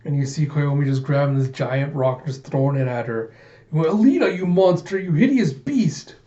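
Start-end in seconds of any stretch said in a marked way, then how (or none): 3.7-6.2 high-pitched angry yell; acted outraged cry; furious accusation. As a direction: hurl the insults like rocks. Koyomi throws rocks at enemy.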